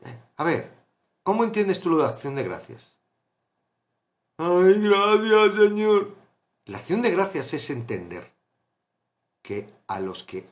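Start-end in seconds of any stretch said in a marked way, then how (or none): 4.4-6.2 louder, higher, heartfelt thanks to the Lord; grateful sigh of thanks. Acted. Believer thanking God in hard times, louder and higher. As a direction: breathe out thanks like a relieved believer.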